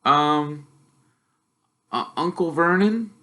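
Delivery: put-on nervous boy asking his uncle; young Harry, unsure and polite; timid boy.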